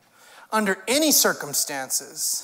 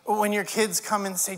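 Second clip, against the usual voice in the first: usual speaking voice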